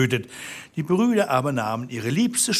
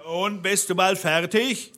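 calling up; 7 semitones higher than usual. A narrator's reading. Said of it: "Da rief der Blaubart unten".

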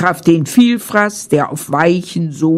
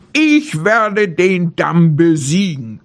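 ordinary delivery, nothing marked

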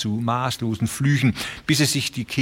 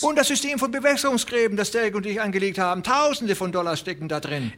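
put-on desperate old rancher; 9 semitones higher, faster than usual. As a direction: wail that they were promised.

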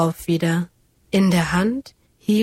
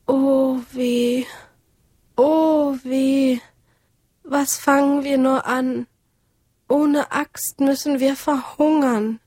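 higher, plaintive wail over the lost axe; wailing lament; 6 semitones higher than usual. A narrator's reading. Woodcutter who lost his axe, higher desperate wail. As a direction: moan it out, heartbroken and helpless. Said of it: "jammerte er untröstlich".

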